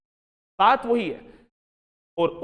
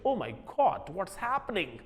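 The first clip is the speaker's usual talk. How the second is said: put-on softer British accent on Oh my God; quiet imitation of a British speaker; 9 dB quieter than usual. Teacher imitating a British speaker reacting, British accent.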